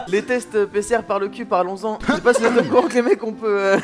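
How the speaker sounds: laughing while talking. Real, not acted. Laughing through the chronicle.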